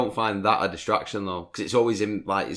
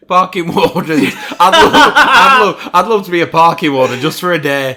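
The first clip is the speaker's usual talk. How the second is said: laughing; 6 semitones higher, 12 dB louder than usual. Real, not acted. Laughing while raising a topic.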